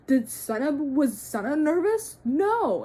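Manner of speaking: loud, high rhetorical challenge; heated indignation. Real, not acted. Fan arguing back at the driver's critics, heated and indignant. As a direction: fire the question out, then a firm loud answer.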